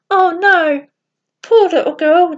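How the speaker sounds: a touch of heartfelt pity; dismayed sympathy. A narrator's reading. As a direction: voice mild shock and sympathy. Children react to dying girl's plight.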